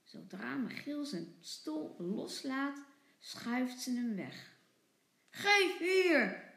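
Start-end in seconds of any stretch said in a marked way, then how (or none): 5.3-6.5 put-on loud, higher angry child's demand; boos roepen. A narrator's reading. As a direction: snap it back like an angry little boy. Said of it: "roept Michiel boos". (boos en luid geroepen)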